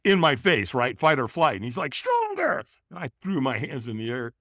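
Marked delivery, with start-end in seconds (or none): 2.0-2.6 very high, strained mock scream; retold scream. Trainer in firewalker's face, mock scream. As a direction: imitate a screamed command without full volume. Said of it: "so he screamed at me".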